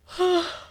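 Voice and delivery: big squeaky yawn; loud high yawn. Real, not acted. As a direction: let out a huge yawn. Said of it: "I'm yawning so much".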